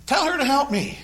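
a frazzled hostess barking an order; mock yelling. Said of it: "She's yelling at Jesus".

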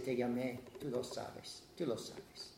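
reproche bajo, casi susurrado al final; Dios reprochando suave. Retold or imitated. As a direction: dilo como un reproche íntimo. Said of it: "El Señor va a decir". (Dios recuerda al creyente su llamado, en voz baja)

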